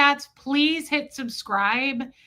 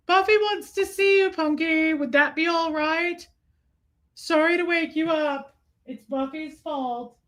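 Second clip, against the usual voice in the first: high, cooing put-on voice; cooing to a pet; 5 semitones higher than usual. Coos to Punky to wake up.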